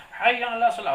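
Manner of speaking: mock muezzin call. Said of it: "teriak-teriak dia".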